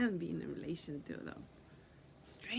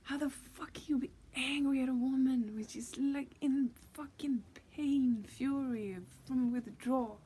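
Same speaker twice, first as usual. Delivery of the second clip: indignant, much higher and louder rant at the spirit; 7 semitones higher, 7 dB louder than usual. Real, not acted. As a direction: hammer home the outrage.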